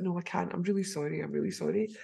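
soft, sheepish apology. Retold or imitated. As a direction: mumble sorry, embarrassed. Apologising for forgetting.

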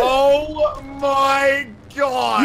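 slow, drawn-out high cry of amazement; awed shout. Real, not acted. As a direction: stretch it out in disbelief. Watching rocket launch.

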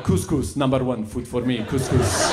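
lightly put-on foreign accent; broken English with a mock foreign accent; ethnicked-up accent. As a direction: enthuse in a fake foreign accent. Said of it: "I can't help but ethnic it up".

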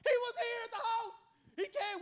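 bursting with the news; excited. Shown as a demonstration. Preacher acting disciples' joy, excited and laughing. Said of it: "Some excitement, right?".

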